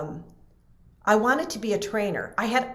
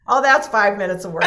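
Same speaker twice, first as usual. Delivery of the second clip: louder mock of his breezy line; imitating the mentor; 2 semitones higher, 8 dB louder, faster than usual. Repeating the mentor's breezy line, in a loud mock voice. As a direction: toss it off like it's nothing, louder. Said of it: "Can't you just hear him say that?".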